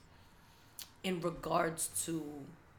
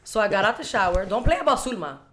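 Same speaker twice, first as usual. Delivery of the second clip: angry rant; 3 semitones higher, 11 dB louder than usual. Retold or imitated. Retold fury defending mom.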